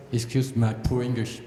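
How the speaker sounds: normal talking voice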